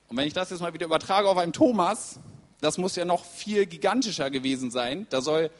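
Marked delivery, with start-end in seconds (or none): none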